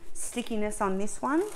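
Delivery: ordinary delivery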